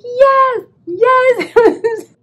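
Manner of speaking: high, loud yes breaking into laughter; laughing yes. Retold or imitated. Confirming to Teresa, laughing excitedly. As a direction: squeal it through laughs. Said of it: "I'm like".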